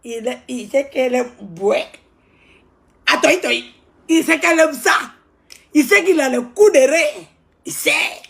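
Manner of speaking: high, loud, fast-paced gossip rant; ranting loud about a show-off; loud animated rant. Acted. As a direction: hype it up, loud and worked up.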